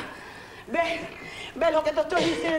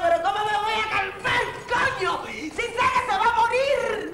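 distraught protest; 7 semitones higher than usual. Acted. Wife protests to the doctor that he will die. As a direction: fight the doctor's calm with panic. Refusing to calm down.

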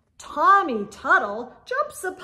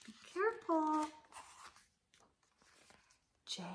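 ordinary delivery, nothing marked

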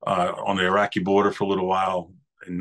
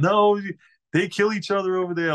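high, louder mock-tearful wail; hammed-up sobbing plea, pitched up; mock crying sister; 10 semitones higher, 3 dB louder than usual. Sister insisting they kill each other there, high mock wail. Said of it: "just crying her eyes out".

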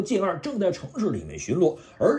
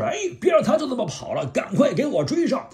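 commanding yell; 7 semitones higher than usual. Acted. Soundwave orders chase.

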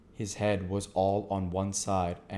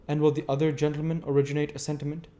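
fast, higher stranger's voice; the stranger, quick; 7 semitones higher, 4 dB louder, faster than usual. A narrator's reading. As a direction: rattle off the polite question. Stranger asks Wopsle.

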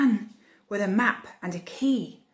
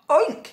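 loud, high oink; quick sharp pig noise; 6 semitones higher, 7 dB louder than usual. A narrator's reading. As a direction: cats pretending to be a hog. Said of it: "said the cats".

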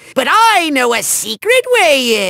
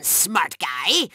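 ordinary delivery

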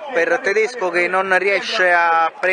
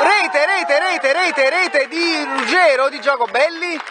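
high, repeating rete, rete; breathless italian goal commentary; 8 semitones higher, slower than usual. Real, not acted. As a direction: call the goal in a rush of joy.

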